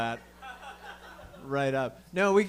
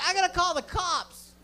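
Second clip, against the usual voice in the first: angry driver. Retold or imitated. Driver gets out of his car to yell at them, mock furious yell. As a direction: bellow like an outraged stranger.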